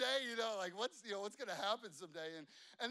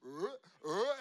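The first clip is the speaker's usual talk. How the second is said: mock gagging; 7 dB louder than usual. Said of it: "He's going".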